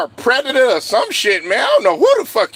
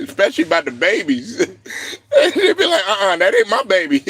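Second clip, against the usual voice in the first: talking through chuckles; amused, breaking into laughter; laughing. Real, not acted. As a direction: crack up while telling the joke. Cracking up at own joke.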